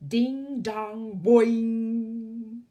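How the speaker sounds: slow, loud, high chiming ding dong; a wobbly grandfather clock going off; clock chimes. A narrator's reading. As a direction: sing out the clock's bongs slowly.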